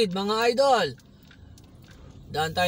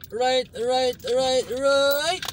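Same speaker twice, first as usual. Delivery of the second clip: excited calling; 5 semitones higher, 4 dB louder, much slower than usual. Real, not acted.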